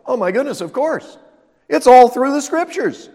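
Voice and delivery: disciples' thrilled "of course"; excited realisation. Pretend. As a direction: burst out with sudden discovery. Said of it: "And then it was like".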